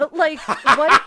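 loud, high, helpless laughter; real laughing fit. Real, not acted. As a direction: crack up while trying to speak. Cracks up at friends' teasing.

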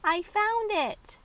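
put-on high, slow, proud little girl; triumphant. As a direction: announce it with pride.

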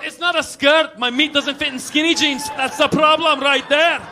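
loud, high-energy Scottish protest; Scottish accent. Retold or imitated. Kilt wearer correcting someone about skirts, loud Scottish accent. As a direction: defend yourself loudly in a Scottish accent. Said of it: "By the way, Scottish voice".

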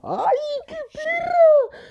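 very high, drawn-out squeal; piercingly high gossipy delight. Real, not acted. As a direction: shriek with glee at the revelation, stretching it out. Delighted reaction to a revelation.

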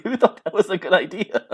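high, loud mock disbelief; incredulous reaction. Retold incredulous reaction, laughing after.

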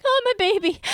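high-pitched, mildly hammed-up "poor baby" reaction; protective cooing dismay. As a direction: retell a parent's pang for their little one. Mother reacting for her child.

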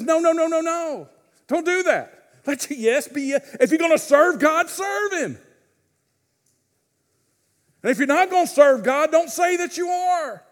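emphatic rebuke. Retold or imitated. James rebuking believers who bargain with God, emphatic and loud. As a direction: forbid it firmly.

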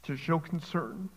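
real choked-up emotion, halting with long pauses; emotional, choked up. Real, not acted. Preacher pleading for suffering churches, choked up. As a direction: fight back tears, leaving long silences between phrases. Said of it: "Sorry for getting emotional".

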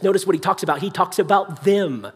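usual speaking voice

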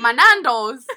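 loud put-on British accent. Shown as a demonstration. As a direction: try Irish but land on British, loudly. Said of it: "That was British".

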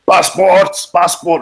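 high mock guard demand; barked border check. Border soldiers demand passports, high barking mock. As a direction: call out passports like a curt guard. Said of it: "they're like".

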